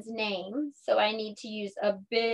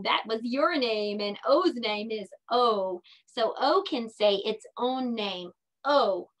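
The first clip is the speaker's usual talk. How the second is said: ordinary talk, nothing marked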